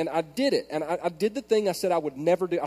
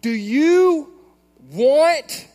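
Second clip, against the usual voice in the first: high, loud, drawn-out mock threat exploding into a shout; retold parental yelling; 8 semitones higher, 11 dB louder, much slower than usual. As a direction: act out a dad yelling at his child, word by word. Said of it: "'cause I'm yelling".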